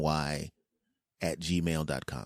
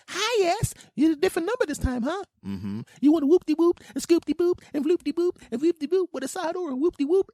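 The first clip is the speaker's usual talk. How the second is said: very high, loud sing-song order taker; cheerful restaurant worker; 6 dB louder than usual. Retold or imitated. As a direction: chirp the order back in falsetto. Restaurant worker again on the call.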